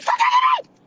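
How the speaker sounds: Kevin Hart shriek. Retold or imitated. Woman reenacting yelling at the man touching her, Kevin Hart style shriek. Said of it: "I hit a Kevin Hart".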